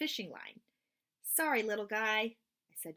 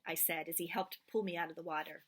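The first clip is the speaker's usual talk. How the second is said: ordinary delivery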